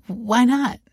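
high-pitched, crestfallen plea. A narrator's reading. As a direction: press for an answer, let down. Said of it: "he pressed, crestfallen".

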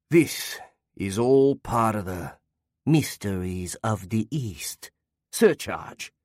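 slow, mystic put-on Chinese accent; mock Chinese accent. A narrator's reading. Omissioner teases Irving with slow put-on Chinese accent. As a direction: ham up the mysteries of the East in Chinese accent. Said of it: "his accent switched for a moment from Australian back to Chinese".

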